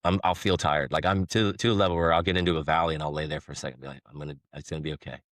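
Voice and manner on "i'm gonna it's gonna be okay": much softer, quick murmur to himself; quiet self-reassurance. Retold or imitated. Tired roofer lying down in a valley, quietly reassuring himself. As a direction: act out quietly talking yourself through it.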